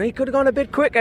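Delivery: high, mock voice of a lad at the trackside; heckling spectator. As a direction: imitate a cheeky fan calling out. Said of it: "you get the odd lad going".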